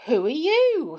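high, loud startled question; surprised. Acted. Peter startled by the strange visitor, surprised. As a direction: blurt it out in surprise. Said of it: "said Peter in surprise".

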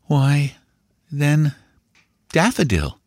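put-on high child's voice, measured; small boy giving up a secret, pitched high; high, deliberate little nephew; young boy confiding. A narrator's reading. Boy reveals his tip.

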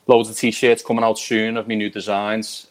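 ordinary delivery

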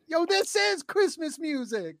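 high-pitched limo driver impression; Argyle the limo driver. Contestant quoting a movie limo driver, high impression. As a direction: pitch it way up, like an excited young driver. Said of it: "best Argyle pr-, uh, impression I've ever seen".

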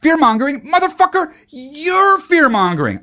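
high, incredulous outburst; indignant retort. Real, not acted. Host answering the preacher's clip, indignant.

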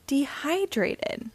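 "Dehydrated" said slowly and softly, syllable by syllable; slow careful repeat. Acted. Girl repeats a new word from her mom, slow and soft. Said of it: "repeats Doc".

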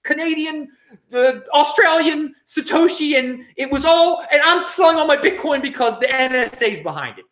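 high-pitched, over-excited conspiracy nut impression; hammed-up fervent believer; mock conspiracy believer. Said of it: "people who love conspiracies will be like".

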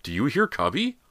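high, insistent question; demanding. A narrator's reading. Boy asking his father about the puppy, demanding. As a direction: press the question, pitched high. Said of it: "he demanded".

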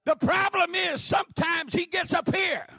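loud, high, fervent pulpit preaching; full-force preaching. Acted. Preacher rousing the congregation with loud fervent preaching. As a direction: preach it out to the whole church.

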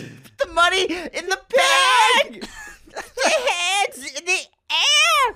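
slow high screaming holdup; bank robber. Retold or imitated. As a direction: drag out each robber scream. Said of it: "Place Beyond the Pines".

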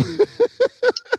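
high laugh bursting out; high burst of laughter. Real, not acted. Host cracks up at his own joke, high laugh.

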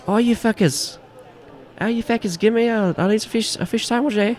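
very high, hammed-up Irish holler; mock Irish shouting, barely intelligible; Irish yelling. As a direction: yell an order to the kitchen in thick Irish. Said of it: "yelling to, like, this other Irish guy".

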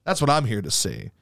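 ordinary talk

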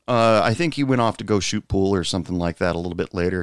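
ordinary delivery

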